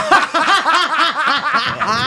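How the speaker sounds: a high, loud squeal of laughter. Real, not acted. Retelling his own lie getting caught, he squeals with laughter.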